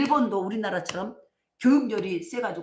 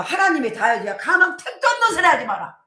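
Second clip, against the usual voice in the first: loud, higher scoffing taunt; mocking taunt; 6 semitones higher, 8 dB louder than usual. Villager taunting the farmer, loud and scoffing. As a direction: scoff at him to his face. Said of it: "이러고 막 놀려".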